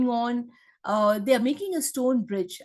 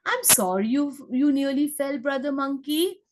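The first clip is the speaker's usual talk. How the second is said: ordinary talk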